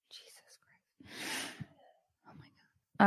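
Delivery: breathed under the breath, fed up; whispered exasperation. Real, not acted. After the interruption, whispered exasperation to herself. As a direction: let it out barely voiced, exasperated.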